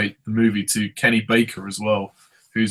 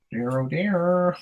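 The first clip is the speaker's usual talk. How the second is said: mock dismay; 8 semitones higher, 3 dB quieter, much slower than usual. Slow high mock dismay.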